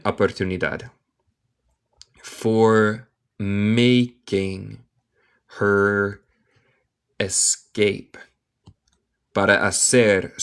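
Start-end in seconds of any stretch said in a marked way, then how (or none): 2.2-8.2 very slow, word by word; teacher's slow, drawn-out model reading; slow dictation. Shown as a demonstration. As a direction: separate each word with a clear pause.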